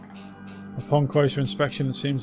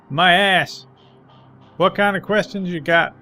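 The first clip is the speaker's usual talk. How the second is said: put-on higher, louder joking old man; cocky old man; 6 semitones higher, 5 dB louder than usual. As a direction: tease the stranger and laugh it off. Old man joking, then defiant.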